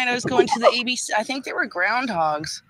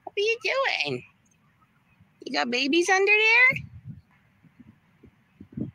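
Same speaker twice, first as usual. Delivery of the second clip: high-pitched sing-song questions to little critters; high voice for the babies; cooing at animals; 12 semitones higher, slower than usual. Real, not acted. Talking to animals she is watching.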